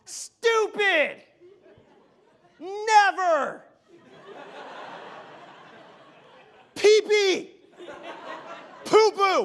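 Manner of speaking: put-on high, loud little-girl yelling, laughing through it; mock a three-year-old shouting bad words; childish squeals of forbidden words; imitating a yelling toddler. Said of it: "yelling every bad word she's not allowed to say".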